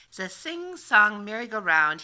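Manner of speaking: usual speaking voice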